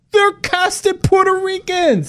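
high, indignant exclamation; exasperated squeak of disbelief. Real, not acted. Indignant outburst.

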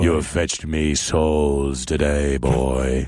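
very low, slow underworld god praising his hound; Hades voice. Acted. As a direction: rumble it slow and deep like a lord of the dead. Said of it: "Hades saying to Cerberus".

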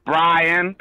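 BRIAN very drawn out in a Kiwi accent; slow Kiwi accent, stretching out Brian; New Zealand accent demo. Shown as a demonstration. Caller shows the last accent, long Kiwi Brian. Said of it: "show me all the different accents".

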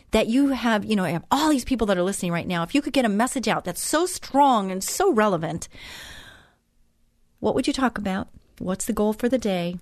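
ordinary talk, nothing marked

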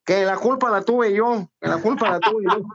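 high mock nasal voice, meek; the nasal trucker backing down meekly; nasal stutterer voice, backing down. Nasal trucker backing down to the huge guy. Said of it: "el gangoso".

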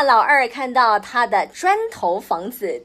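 plain reading voice

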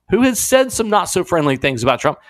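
normal talking voice

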